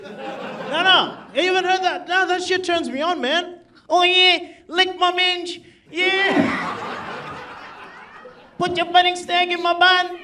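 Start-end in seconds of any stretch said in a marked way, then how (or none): 3.9-10.2 much higher, hammed-up Australian female accent; mock Australian woman's accent. Comic mocking the Australian female accent he finds sexy, high hammed-up Aussie woman voice. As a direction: put on a breathy-sounding Aussie woman's voice for laughs, much higher pitch. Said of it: "the Australian female accent is the sexiest accent".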